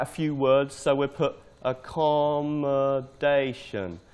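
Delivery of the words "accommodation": slow, drawn-out "accommodation"; typing aloud. Shown as a demonstration. Teacher typing a word list, slowly sounding it out. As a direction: say "accommodation" slowly as you write it.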